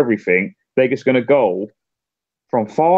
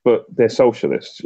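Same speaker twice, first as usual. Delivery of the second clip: ordinary talk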